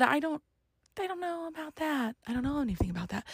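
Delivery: softly backing away from the claim; small, hushed "I don't know"; quiet nervous denial. Retold or imitated. Young borrower to creditor, hushed and unsure. Retold own nervous reply.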